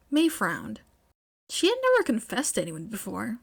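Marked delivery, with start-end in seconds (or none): none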